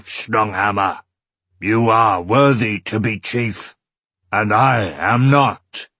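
loud, slow Urgel proclamation; rough-voiced public surrender, weighty and deliberate. A narrator's reading. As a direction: concede aloud for all to hear, rough creature voice. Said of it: "Raising his voice so that all".

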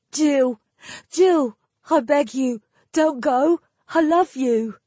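high, loud, desperate plea; desperate begging. A narrator's reading. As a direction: beg a lover not to go. Perrin begs her not to go.

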